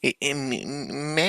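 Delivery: soft mock stammer; halting, stuttered cartoon impression; Jimmy. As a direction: quietly imitate a stutterer stuck on words. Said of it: "Jimmy or whatever his name from South Park".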